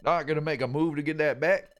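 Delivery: lightly voiced taunting thug; bully taunt. Bully Pokemon goading the partner, lightly voiced. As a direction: goad them to try. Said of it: "Nice voice".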